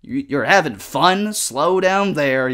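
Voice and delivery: hammed-up mild scolding; mock disapproval. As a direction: play a disapproving elder reining someone in. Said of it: "It's like".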